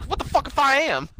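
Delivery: high-pitched imitation of a comeback; mock indignant retort. Imitating her answer to his jab, high indignant retort.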